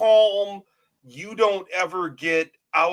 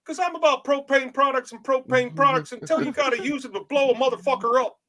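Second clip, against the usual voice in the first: Hank Hill impression; 9 semitones higher than usual. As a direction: play Hank Hill with a violent streak. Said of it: "Hank Hill from King of the Hill".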